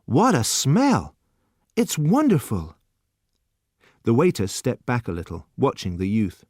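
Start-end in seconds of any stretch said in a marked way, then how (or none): 0.0-2.8 very slow, higher marvelling; delighted. A narrator's reading. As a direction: breathe it in with wonder. Delight at smell.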